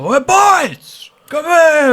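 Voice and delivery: high, put-on excited call; well excited greeting. Michelle greeting the boys, well excited. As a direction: hail the lads in a high mock-excited voice. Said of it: "he's well excited".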